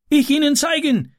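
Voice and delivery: put-on eager boy offering to lead; Ram calling. A narrator's reading. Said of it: "rief Ram".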